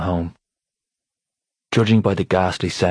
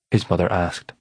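ordinary delivery

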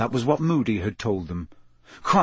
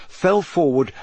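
ordinary delivery

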